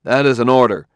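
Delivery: a crisp officer's order; crisp command. A narrator's reading. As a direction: clip the command out sharply. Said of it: "said Bailey crisply".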